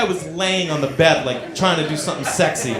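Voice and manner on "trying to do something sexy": usual speaking voice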